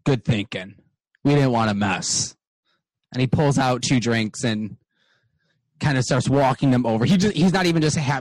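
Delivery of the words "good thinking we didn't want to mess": a touch of grumbly satisfaction; lightly voiced dwarf bartender; gruff dwarf voice. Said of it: "Arnold kinda looks at you and goes".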